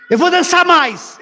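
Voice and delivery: loud, high defiant retort; defiant shout. Retold or imitated. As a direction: fire back in defiance. (Davi gritando, desafiador)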